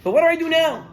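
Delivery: loud, high exasperated question; mock desperate appeal; helpless plea. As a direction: act out being at a total loss. Acted complaint of confused believer.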